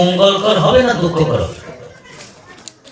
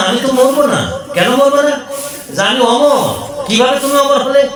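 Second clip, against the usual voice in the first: higher, pressing, incredulous wife; challenging Parvati; 5 semitones higher than usual. Acted. Parvati challenges Shiva about his immortality, higher and pressing. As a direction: demand how he stays alive.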